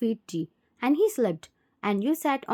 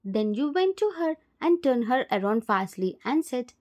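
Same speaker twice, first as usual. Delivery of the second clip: ordinary delivery, nothing marked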